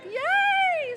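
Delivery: very high squeal of joy; high delighted yay; excited cheer. Retold or imitated. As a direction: cheer like you just won. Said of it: "I'm like".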